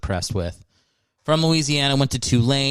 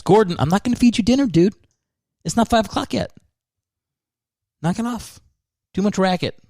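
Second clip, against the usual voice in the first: much higher-pitched telling-off of a pet; exasperated scolding; 10 semitones higher, faster than usual. Real, not acted. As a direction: break off to scold a noisy pet. Scolding pet that interrupts the recording.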